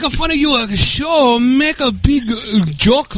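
high, halting caricature voice; Dennis. Acted. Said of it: "So go do Dennis".

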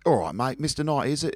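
imitating a chummy Cockney dad; bad Cockney accent. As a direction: put on a dodgy Cockney bloke. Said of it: "my bad Cockney accent".

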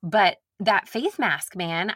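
ordinary talk

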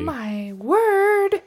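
prim, high southern belle gasp. Pretend. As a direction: clutch the pearls, scandalized. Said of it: "Southern Bells".

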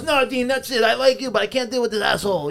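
high, exasperated owner imitation; mock exasperated refusal; mad club owner. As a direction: play the fed-up owner, pitched high. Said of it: "he's fucking mad".

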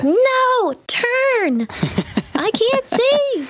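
high-pitched, slow panicked plea. Retold or imitated. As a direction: squeal it like a panicked player. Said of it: "It's like".

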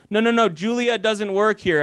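high, light mock of a confused receptionist; dismissive receptionist. Receptionist brushing off the Trulia caller, lightly mocking. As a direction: toss it off as the clueless person on the phone. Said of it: "They go".

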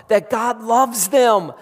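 God loves them said slowly and firmly; slow emphatic statement. Acted. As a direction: lay it down slowly, deliberately. First take, slower.

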